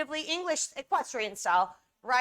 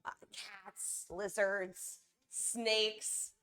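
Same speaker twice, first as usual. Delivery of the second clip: soft cooing; 4 semitones lower, 6 dB quieter, much slower than usual. Retold or imitated. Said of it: "And I'm like".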